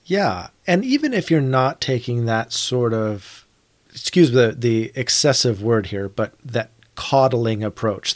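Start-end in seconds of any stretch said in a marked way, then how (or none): none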